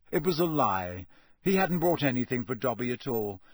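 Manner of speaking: ordinary delivery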